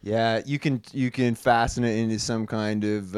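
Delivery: ordinary talk, nothing marked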